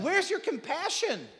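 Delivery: high, pleading reproach; mock wounded reproach. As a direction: put on a high, hurt plea. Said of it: "she could have said".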